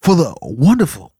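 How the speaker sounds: booming announcer voice; grand announcement. Acted. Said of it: "this is a grand announcement".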